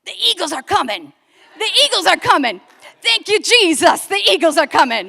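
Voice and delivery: loud, high, overjoyed shouting; excited yelling. Retold or imitated. Grace yelling down the hall, joyful loud shouting. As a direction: yell it like rescue has come. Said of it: "I hear Grace yelling".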